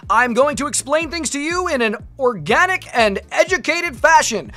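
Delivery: loud, high, bouncy YouTuber intro; Markiplier impression. Streamer parodying a YouTuber's energetic intro, hammed-up. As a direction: ham up an overexcited creator greeting. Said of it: "how does Markiplier do it? It's like".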